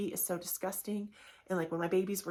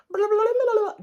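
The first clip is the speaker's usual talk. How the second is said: shrill, much louder blah blah; mock nagging; 13 semitones higher, 12 dB louder, faster than usual. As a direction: parody the endless nagging. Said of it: "my kids are like".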